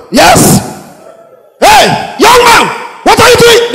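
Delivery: put-on high loud bark at a young man; intimidating shout. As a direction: shout him down like a bullying cop. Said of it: "They want shout to intimidate you".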